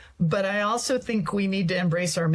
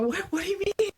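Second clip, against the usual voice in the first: baffled self quote; 12 semitones higher than usual. Retold or imitated. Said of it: "I go".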